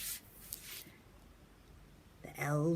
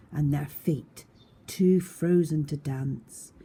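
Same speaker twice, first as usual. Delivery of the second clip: usual speaking voice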